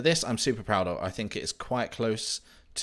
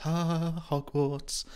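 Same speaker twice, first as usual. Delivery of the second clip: mock awe; 9 semitones higher, much slower than usual. Awed reveal of the castle.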